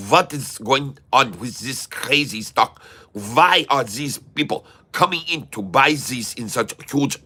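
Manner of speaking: hammed-up German accent for stock chat. Streamer ranting about SoFi buyers, in mock German accent. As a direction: keep a thick comic German accent throughout. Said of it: "No, this is my German accent".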